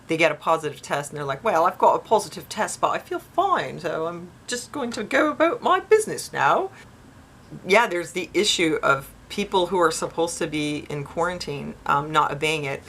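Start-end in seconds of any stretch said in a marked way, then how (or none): 1.4-6.7 high put-on voice, rising as it goes; mock unbothered person. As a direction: imitate someone breezily carrying on regardless. Acts out unbothered positive-tested people.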